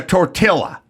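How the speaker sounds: "tortilla" with a hard L, "tor-till-a"; L sounded in tortilla. Shown as a demonstration. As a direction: pronounce the double L in "tortilla" as L. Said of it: "you said the double L".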